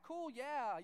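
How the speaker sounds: high, eager mock agreement; feigned enthusiasm. Man noting cricket terms he barely knows, feigning enthusiasm. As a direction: play along keenly.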